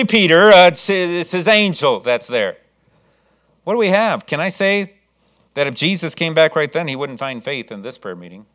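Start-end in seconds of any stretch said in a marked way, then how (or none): none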